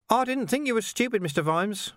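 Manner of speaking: high, chirpy villain with a laugh; mock innocence, grinning high; cheerful menace, high-pitched; Carcer's voice. A narrator's reading.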